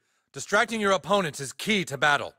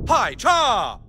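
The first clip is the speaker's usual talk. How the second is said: high two-part battle cry; karate yell; 6 semitones higher, 4 dB louder than usual. Acted. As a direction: shout it with each strike. Martial arts strike yell.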